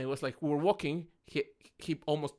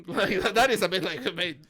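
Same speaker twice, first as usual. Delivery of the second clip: louder through a laugh; amused, raised voice; 4 semitones higher, 9 dB louder than usual. Real, not acted. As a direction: laugh while you say it.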